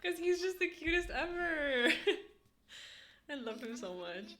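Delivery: high squeal breaking into laughter; real high, giggly, choked-up coo; gushing adoration. Real, not acted. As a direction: melt over how cute he is, stretched and high. Gushing about grandpa, tearful laugh.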